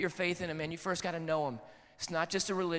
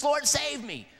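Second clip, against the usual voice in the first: higher, louder urgent cry for help; 7 semitones higher, 4 dB louder, slower than usual. Retold or imitated. Peter crying out as he sinks, loud urgent cry. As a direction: call it out like a man sinking. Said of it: "Peter said that".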